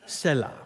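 "Selah" said as SELL-uh; short e, falling away on "Selah"; preferred pronunciation. Shown as a demonstration. Pastor repeats his preferred form, "Selah" as SELL-uh. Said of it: "this is my preference".

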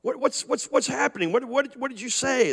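a hint of a bewildered grandmother; startled grandma. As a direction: fluster like a surprised grandma. Grandma runs in, confused.

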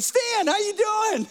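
lightly voiced chirpy phone greeting; cheerful greeting. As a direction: greet an old friend brightly on the phone.

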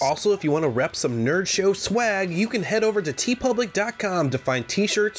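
loud, high announcer read; podcast outro read. Acted. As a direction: pitch the show like a radio ad. Hosts read scripted outro.

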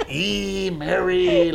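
exaggerated father-in-law voice; Daddy Raymond. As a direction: put on a mocking imitation of the father-in-law. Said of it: "He talks like this".

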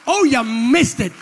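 loud, high fiery sermon; preaching at full force. Acted. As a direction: preach it hard to the crowd.

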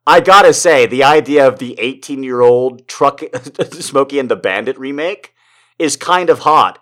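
ordinary delivery

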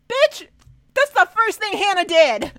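high, loud exasperated outburst; outraged shout. Real, not acted. Podcast host outraged at Ethan, shouting. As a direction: yell it in disbelief at the screen.

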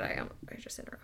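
fast apologetic whisper; quick whispered aside. Real, not acted. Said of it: "sorry, go ahead".

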